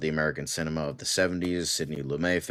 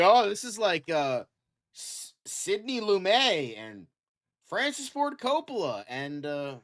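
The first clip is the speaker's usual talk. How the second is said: high, slow, mocking voice of a pompous actor; sneering high impression drawn out slowly; mocking gushing actor; 13 semitones higher, slower than usual. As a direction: send up his earnest name-dropping, pitched way up.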